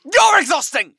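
petulant shouted comeback; shouted retort. Acted. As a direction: snap it back, loud and high. Bickering hosts, shouted retort.

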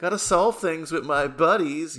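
high-pitched, goofy kid-brother impression. Film fan mimicking the kid brother's side of the movie, high and goofy. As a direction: pitch it way up like an eager little brother. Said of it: "goofy, you know, kid brother".

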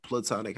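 PLATONIC said "pluh-TON-ik"; first-syllable schwa. Shown as a demonstration. Host settling between two ways to say it, platonic as "pluh-TON-ik". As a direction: go back to a short "pluh" at the start of platonic. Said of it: "however you say it".

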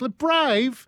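high-pitched, disbelieving echo; incredulous scoff. Real, not acted. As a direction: throw BRAVE back in high disbelief. Scoffs at the quoted word.

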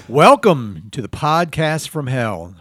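slow, grand podcast welcome; show opening announcer. Acted. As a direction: stretch out the host's greeting. Opens the show again.

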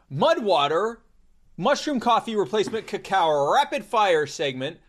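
upbeat sponsor announcer read. Acted. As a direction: sell it like a radio ad.

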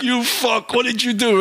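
high-pitched mock scolding; imitated exasperated boss. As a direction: reenact a boss's dismayed reproach. Boss arrives, gives exasperated look.

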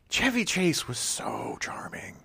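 soft, slow, breath-heavy admiration; slow, hushed awe; hushed gushing. Real, not acted. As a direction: drop to a swooning near-whisper. Gushing over the actor.

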